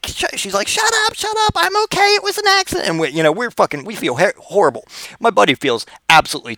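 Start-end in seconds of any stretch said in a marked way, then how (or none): 0.7-2.9 very high female falsetto; squeaky girly voice waving it off; injured girl hushing her boyfriend, falsetto; girlfriend. Retold or imitated.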